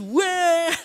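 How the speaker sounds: a short high mock whimper; child's wail. Father imitates his crying son, high mock whimper. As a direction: imitate a little boy starting to cry.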